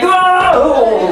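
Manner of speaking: high, loud drawn-out whoop; excited whoa. Acted. Teacher imitating kids running around, high excited whoop. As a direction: whoop like an overexcited kid.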